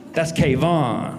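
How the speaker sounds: slow, drawn-out Kayvon; slow name correction. Retold or imitated. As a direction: say Kayvon slowly, stretching each syllable. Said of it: "I'm like".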